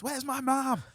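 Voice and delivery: high-pitched acted cry for mum; hammed-up panicked yell, voice way up; mock yell. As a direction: yell it high without full volume. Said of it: "I would, like, yell this".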